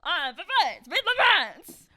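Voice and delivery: loud, high, silly fake French; mock French accent. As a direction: belt it out in a cartoon French accent. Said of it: "Wah, wah".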